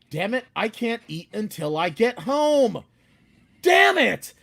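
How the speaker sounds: rising into a loud, high outburst; hungry and fed up, let it burst out; hangry frustration. Retold or imitated.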